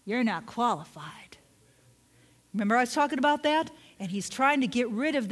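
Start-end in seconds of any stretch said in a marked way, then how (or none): 0.1-1.4 whispered, as a sly inner voice; accusing whisper. Acted. Preacher voicing the inner accuser, whispered and sly. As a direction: hiss it like a taunt in the ear.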